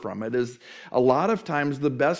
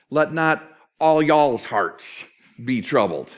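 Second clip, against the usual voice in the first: slow emphatic paraphrase; 4 dB louder than usual. Shown as a demonstration. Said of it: "And your there is plural".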